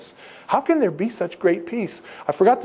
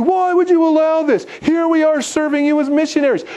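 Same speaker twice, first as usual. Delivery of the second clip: much higher, louder, accusing God in furious outrage; angry accusing prayer; 9 semitones higher, 3 dB louder than usual. Retold or imitated. Parent of a sick daughter accusing God, high and furious. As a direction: hurl it at God like a wounded parent.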